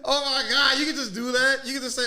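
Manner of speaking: high, loud incredulous outburst; amused disbelief. Real, not acted. Streamer reacting to a debater's sarcasm excuse, loudly incredulous.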